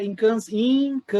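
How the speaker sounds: "incansável" loud, high, stretched syllable by syllable; emphatic stress. Real, not acted. Preacher re-reading the quote to the church, heavy stress on "incansável". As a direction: hammer out every syllable of "incansável".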